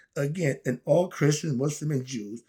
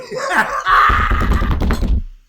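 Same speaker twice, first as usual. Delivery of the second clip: loud high laugh; 11 dB louder than usual. Real, not acted. Laughing at own rant.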